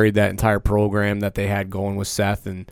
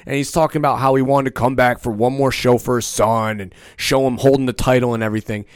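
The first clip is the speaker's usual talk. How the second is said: ordinary delivery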